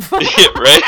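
ordinary delivery

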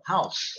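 "House" as one syllable ending in "s"; correct house. Shown as a demonstration. Teacher showing how to say casa in English, correct one-syllable "House". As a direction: model "House" cleanly, no final vowel. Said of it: "é assim que fala casa em inglês".

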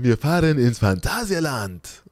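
slow, drawn-out mock proclamation; excited father. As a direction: proclaim a treat grandly, imitating Dad. Father proclaims the theme park trip. (feierlich gedehnt, gespielte Begeisterung)

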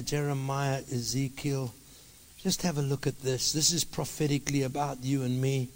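hushed, gentle closing address; soft, settled pastoral voice; calm, soft sermon close. Real, not acted. Soft, calm closing after loud preaching.

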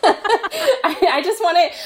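laughing through the words; giggling as she pleads; half laughing, half begging. Real, not acted. Laughing plea to Luke.

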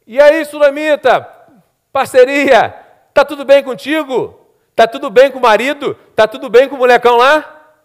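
boisterous acted greeting with breezy questions; servant hailing her. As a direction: greet her from a distance, chummy and loud. Servant hails the woman.

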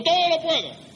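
high mock chant; glib recitation. Preacher mimicking believers saying the verse, glib. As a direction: toss it out high-pitched, mimicking. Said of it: "Y van diciendo".

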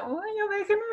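high, crying mock whine; exasperated whine. Teen begging relatives to stop, high exasperated whine. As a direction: whine to be left alone. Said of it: "y yo".

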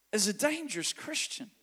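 ordinary talk

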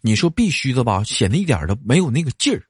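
ordinary delivery

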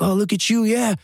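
high, taunting barroom jeer; mocking bar tough guy. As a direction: sneer it down at him like a drunk bully. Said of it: "they'll say stuff to me like".

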